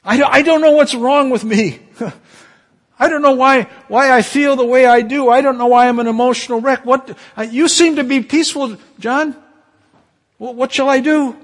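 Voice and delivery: high, loud, tearful-sounding sinner; distressed sinner. Acted. Churchgoer confessing to peaceful John, high anguished voice. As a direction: play a person undone by guilt, begging.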